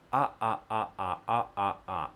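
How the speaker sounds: short "ah"s each cut off by a throat stop; glottal stop drill. Shown as a demonstration. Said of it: "repeat the glottal T sound".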